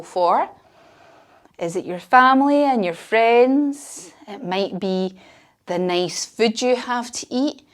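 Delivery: put-on soft bedtime-style relaxation for kids lying on mats; guided relaxation. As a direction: guide the children to rest, gently and quietly. Leads relaxation at end.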